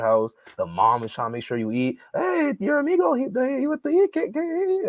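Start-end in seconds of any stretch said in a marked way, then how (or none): 2.1-4.9 high, fussing immigrant mom voice; friend's mom insisting the guest eat; Spanish mom. Retold or imitated. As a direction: mimic the mom pushing food on the guest. Spanish mom voiced offering food.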